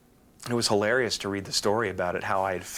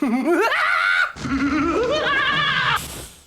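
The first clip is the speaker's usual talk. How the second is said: high, loud villain cackle; manic laugh; 9 dB louder than usual. Shown as a demonstration. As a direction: cackle like a madman. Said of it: "how does yours go".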